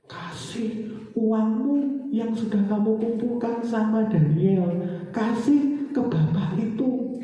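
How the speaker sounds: high, slow, loud proclamation; divine command. Acted. God telling the wife to give away savings, slow commanding proclamation. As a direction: draw out each word as a solemn order.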